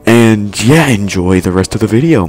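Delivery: loud energetic rushed chatter; loud excited talk. Real, not acted. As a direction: shout it over the chaos. Loud hurried outro before dying.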